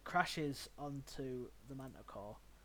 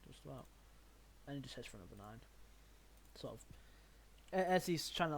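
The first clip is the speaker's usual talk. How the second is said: normal talking voice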